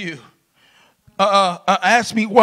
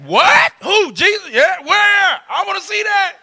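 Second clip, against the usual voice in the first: mock surprise; 8 semitones higher than usual. Mock shocked congregation member.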